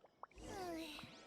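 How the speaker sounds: a tired, resigned sigh. Acted. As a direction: sigh and let it go. Giving up on the favour.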